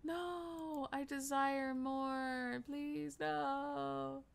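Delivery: high, drawn-out begging; pleading whine. Real, not acted. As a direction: draw it out in a slow pleading wail. Pleads for the game to continue.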